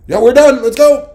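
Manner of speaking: loud, high, hurried "let's go"; rushed wife. Retold or imitated. Wife announcing they're done and leaving, loud and high. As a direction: hustle everyone out the door.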